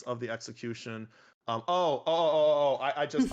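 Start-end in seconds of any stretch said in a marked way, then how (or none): 1.7-3.3 loud, higher burst of remembering; sudden realization. Real, not acted. Speaker just thought of judge's feedback, sudden realization. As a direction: blurt it out as an idea strikes.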